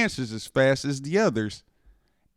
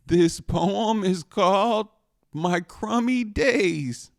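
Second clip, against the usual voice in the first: nervous voice cracking; 6 semitones higher, slower than usual. Acted. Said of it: "my voice cracking".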